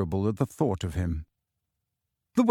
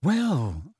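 usual speaking voice

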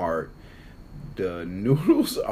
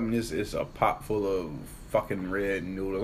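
ordinary talk

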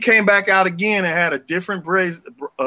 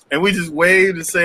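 usual speaking voice

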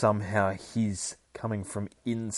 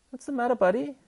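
much higher pet-talk voice; talking to the dog; faster than usual. Real, not acted. Streamer checking on his dog, high cooing voice. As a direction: ask the dog gently.

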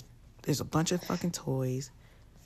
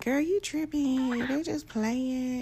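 high, slow mocking voice; scolding a friend for overreacting, high mock drawl; mocking dismissive friends; 9 semitones higher, slower than usual. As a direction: parody people brushing it off, pitched high and drawn out. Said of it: "they be like".

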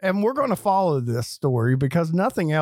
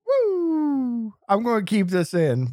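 usual speaking voice

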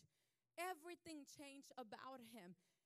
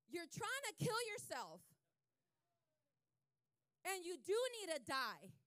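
loud, raised radio-preacher delivery; higher, punched-out rebuke from the pulpit; forceful preaching; 5 semitones higher, 10 dB louder than usual. Retold or imitated. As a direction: proclaim it like a preacher over the radio. Radio preacher's words retold, preached out.

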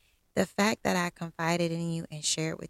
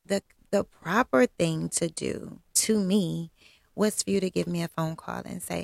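ordinary talk

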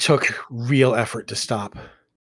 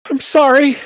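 high, loud whimpered apology; whimpering sorry; 11 dB louder than usual. Acted. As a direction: whimper it like a hurt child. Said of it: "I whimpered".

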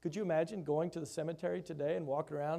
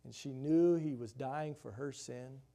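ordinary delivery

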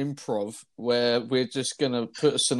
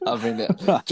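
laughing; 10 semitones higher than usual. Real, not acted. Laughing at Luke story.

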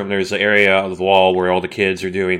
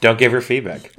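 ordinary delivery

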